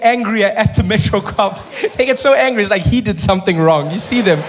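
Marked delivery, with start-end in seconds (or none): none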